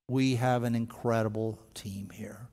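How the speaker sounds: hushed, confiding whisper; whispered aside. Real, not acted. To the congregation, a quick whispered aside. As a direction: lean in and whisper it. Aside praising the church team.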